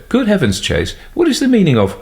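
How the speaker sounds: high startled exclamation, cut off; shocked outcry. A narrator's reading. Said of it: "Mister Hislop said".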